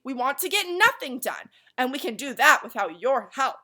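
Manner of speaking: loud, high, irritated retort; angry snap. A narrator's reading. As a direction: bite back hard at the boy. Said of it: "snapped another angrily".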